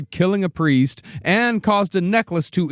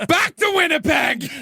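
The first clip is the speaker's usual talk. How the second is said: mock yell; 13 semitones higher than usual. Joins the dad bit.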